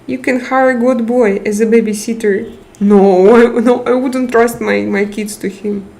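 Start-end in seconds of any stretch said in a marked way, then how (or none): none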